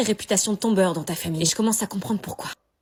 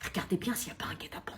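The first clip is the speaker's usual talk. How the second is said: whispering while hiding; 4 semitones lower, 10 dB quieter than usual. Acted. Whisper inside strange house.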